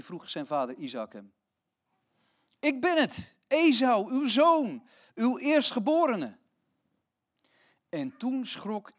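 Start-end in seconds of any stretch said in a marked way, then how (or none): 2.6-6.4 put-on eager, higher young son's voice; Esau answers. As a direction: announce yourself eagerly to father. Esau answers his blind father.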